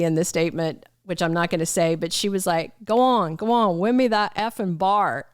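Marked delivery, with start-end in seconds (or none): 2.8-5.3 put-on a light go at Teeter's Texas accent; deep rural Texas accent. Vlogger mimics Teeter at the carnival, rural Texas accent. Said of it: "that was the accent".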